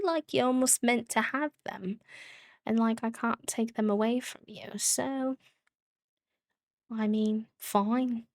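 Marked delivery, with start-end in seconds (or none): none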